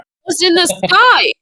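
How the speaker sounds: talking with mouth full; chewing while speaking. Real, not acted. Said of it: "What are you eating in there?".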